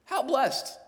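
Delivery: "How blessed" high and falling; exclaimed statement. Shown as a demonstration. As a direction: cry out "How blessed" in wonder. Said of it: "it's a statement".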